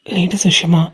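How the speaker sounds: hushed, unvoiced whisper of a secret phrase. Boy whispers to friends about ladies' matter, hushed whisper. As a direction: lean in and whisper it as if it were forbidden.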